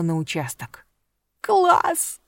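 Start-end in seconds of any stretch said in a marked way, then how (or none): 1.4-2.2 high, bubbly squeal of joy; squealing with delight. A narrator's reading. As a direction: squeal it like an excited girl. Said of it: "запищала от восторга Алиса".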